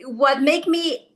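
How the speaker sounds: usual speaking voice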